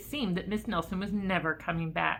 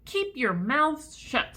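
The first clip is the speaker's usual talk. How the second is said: strict teacher; 7 semitones higher, 3 dB louder, slower than usual. A narrator's reading. Miss Swamp scolds the class.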